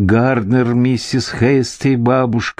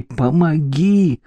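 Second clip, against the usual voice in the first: moaned plea; 6 semitones higher, slower than usual. A narrator's reading. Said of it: "простонала она".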